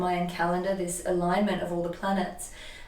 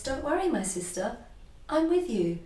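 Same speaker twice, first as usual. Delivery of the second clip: higher, lightly voiced soothing guide; gentle guide voice; 7 semitones higher than usual. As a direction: reassure her in a light high voice. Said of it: "I hear this".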